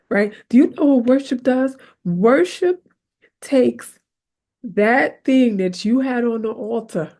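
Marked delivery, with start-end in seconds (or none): none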